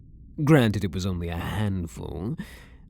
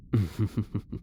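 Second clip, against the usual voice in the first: a quiet teasing chuckle; soft laugh; 4 dB quieter than usual. Acted. Prisoner chuckling softly at his coy guard.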